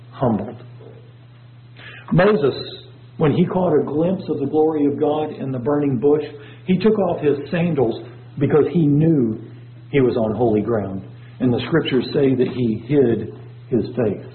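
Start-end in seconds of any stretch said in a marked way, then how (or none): none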